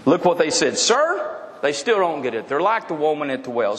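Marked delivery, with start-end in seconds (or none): 0.8-1.4 high, pleading "Sir" of a clueless crowd; mock crowd voice. Crowd begging Jesus for bread, high mock plea. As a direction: play the crowd that doesn't get it.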